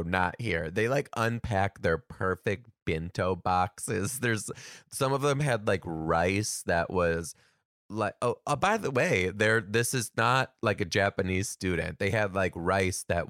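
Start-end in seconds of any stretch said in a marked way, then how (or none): none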